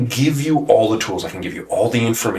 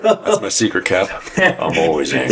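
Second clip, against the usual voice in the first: lightly voiced Hulk line, pitched higher, laughing; 6 semitones higher than usual. Host quoting the Hulk to his friends, a hint of Hulk. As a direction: toss off the famous angry-hero line as a joke. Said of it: "If he was the Hulk".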